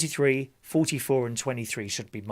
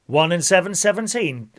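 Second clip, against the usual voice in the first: bingo caller; 4 semitones higher, 5 dB louder than usual. Acted. Calls bingo numbers.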